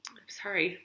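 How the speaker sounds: whispered sorry while crying; apologizing to viewers for crying, whispered; teary whispered apology. Real, not acted. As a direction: apologize under your breath, tearful. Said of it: "I'm not always this emotional".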